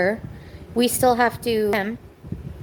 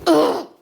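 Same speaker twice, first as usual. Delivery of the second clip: a loud, exasperated ugh; a fed-up groan at the husband; frustrated groan; 6 semitones higher, 5 dB louder than usual. Real, not acted. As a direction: groan the anger out. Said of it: "I'm just so fucking ma".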